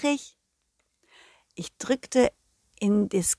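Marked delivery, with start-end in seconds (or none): none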